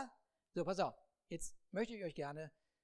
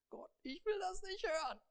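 much higher, whining voice; whiny Moses; 12 semitones higher than usual. Acted. Moses whines to God that he won't listen. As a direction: whine like a reluctant leader.

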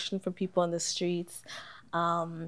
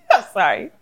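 fighting back laughter; laughing while trying to stop; stifled giggles; 5 semitones higher, 9 dB louder than usual. Real, not acted. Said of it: "I'm trying not to laugh".

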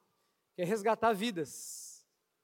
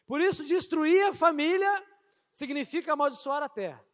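higher, fervent preaching; 5 semitones higher than usual. Acted. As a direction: build the sermon with fervor. Preaching at rising intensity.

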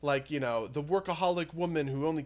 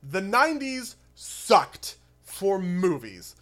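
loud exasperated rant; 4 semitones higher, 9 dB louder than usual. Real, not acted. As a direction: vent it, laughing in disbelief. Ranting about nineties movies.

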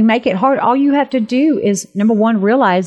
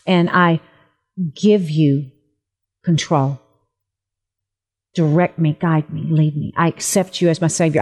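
sinner's prayer; 3 semitones lower than usual. Acted. Leads listeners in prayer.

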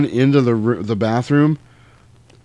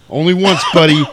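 loud, higher one-liner; cocky hero comeback; 5 semitones higher, 6 dB louder than usual. Retold or imitated. Sam answers the vampire before shooting, cocky shout. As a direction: deliver it as a hammed-up retort. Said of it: "And Sam goes".

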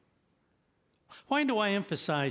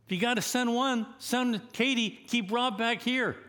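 joking aside; 9 semitones higher, 4 dB louder than usual. Pretend.

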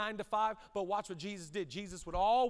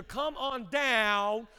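drawn-out call; 6 dB louder, much slower than usual. Acted. God calling Jeremiah, preaching.

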